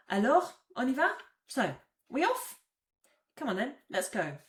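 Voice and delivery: rally everyone to leave; eager. Shown as a demonstration. Teacher rousing the group to head off, eager. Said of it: "call to action".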